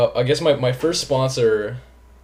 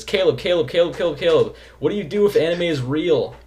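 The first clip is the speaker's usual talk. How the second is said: restrained mock yelling of a written question; mock all-caps shouting, held back; 5 semitones higher than usual. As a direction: read it like all caps but keep it down at night. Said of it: "I'm not gonna yell it 'cause it's nighttime".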